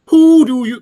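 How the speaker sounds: very high, loud hooted Welsh attempt; attempted Welsh accent. Host retrying a line for the chat box, badly faked Welsh accent. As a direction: fake a Welsh accent like an owl hooting. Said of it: "can you do Welsh better than me?".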